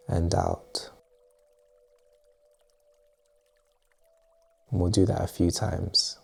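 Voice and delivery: calm meditation guide, long pauses between phrases; unhurried, quietly spoken breathing exercise; guided meditation. Acted. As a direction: ease the listener gently into relaxation, softening at phrase ends.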